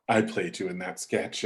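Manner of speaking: low, soft acted admission; sheepish confession. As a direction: own up quietly. Confession continues.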